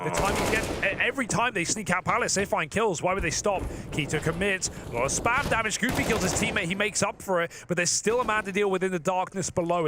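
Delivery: high, energetic play-by-play; esports caster. Real, not acted. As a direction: hype up each kill. Fast fight play-by-play.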